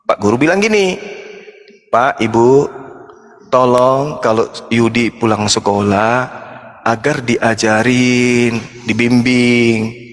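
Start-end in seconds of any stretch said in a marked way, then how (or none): 1.9-10.0 low, very slow earnest teacher plea; solemn teacher. Retold or imitated. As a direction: lay it out gravely to the parents. Teacher solemnly addresses parents. (guru bicara pelan dan rendah kepada orang tua)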